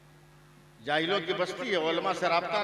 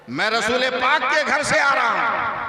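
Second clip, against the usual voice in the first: put-on louder, higher replay of the boy's line; repeated punchline; 5 semitones higher, 7 dB louder than usual. Shown as a demonstration. Preacher repeats the boy's reply louder to the crowd. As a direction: hammer the punchline home for the crowd. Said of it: "सुभान अल्लाह बोलिए".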